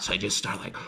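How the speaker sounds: ordinary talk, nothing marked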